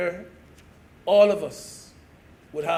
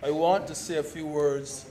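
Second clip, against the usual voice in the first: ordinary talk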